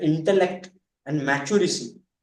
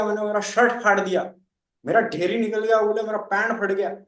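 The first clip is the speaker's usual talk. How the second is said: ordinary talk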